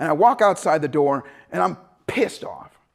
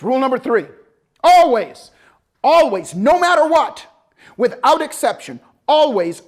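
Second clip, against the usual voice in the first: louder, higher, drawn-out insistence; emphatic rule; 5 semitones higher, 6 dB louder than usual. Retold or imitated. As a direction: hammer each always home. Instructor laying down the rule.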